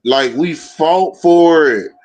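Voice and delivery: very slow, drawn-out words; slow motion. Real, not acted. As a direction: stretch every word out. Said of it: "why did this dude talk like he's struggling to talk".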